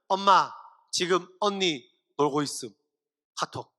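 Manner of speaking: low, slow, deadpan sibling's text; tattling text message. Acted. Younger sibling texts mom on a studying older one, deadpan tattling. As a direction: read it out slowly like a tattletale text. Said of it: "엄마한테 카톡하는 거예요".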